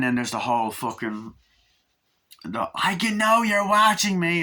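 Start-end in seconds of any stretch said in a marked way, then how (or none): 2.7-4.4 high loud mock threat; a villain calls out; hammed-up spooky voice; menacing line.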